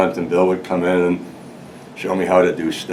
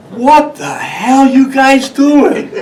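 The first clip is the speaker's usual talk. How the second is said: mock outraged boss, much higher and louder, slow; angry boss; 7 dB louder, much slower than usual. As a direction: ham up the boss catching them drinking, high and drawn out. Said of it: "And he goes".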